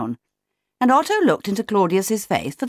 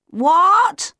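high, loud yelled question; 12 semitones higher, 5 dB louder than usual. A narrator's reading. Otto failing to hear Leo, yelling. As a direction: yell back as if you missed it. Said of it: "yelled Otto".

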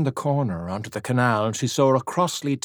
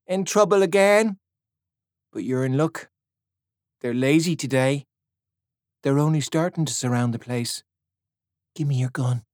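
put-on teasing girl; 4 semitones higher than usual. A narrator's reading. Girl to Simon, high and teasing. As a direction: tease him playfully.

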